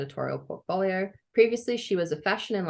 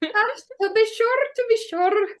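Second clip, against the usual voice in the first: high, hammed-up Irish brogue; hammed-up Irish accent; 4 dB louder than usual. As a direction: lay the Irish accent on thick. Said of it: "I'm suddenly Irish".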